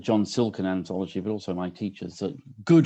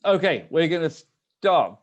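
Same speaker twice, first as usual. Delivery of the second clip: raised, higher voice to open a meeting; calling to order; 8 semitones higher, 7 dB louder, slower than usual. Real, not acted. Host to gathered online audience, calling them to start. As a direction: call out slowly over the chatter.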